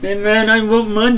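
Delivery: high, loud, put-on voice for a man asking for money; begging emailer voice. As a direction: whine for cash at Bill.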